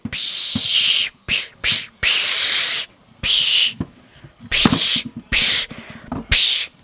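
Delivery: unvoiced psh spray noises; spray hiss sound. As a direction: mimic a spray can. Spraying again.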